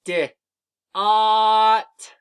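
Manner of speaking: D then ot, each sound held long and loud; D, then ot, sounded out slowly and loudly; sounding out words. Shown as a demonstration. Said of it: "Let me do it with you".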